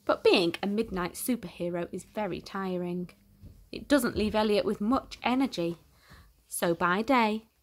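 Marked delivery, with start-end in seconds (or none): none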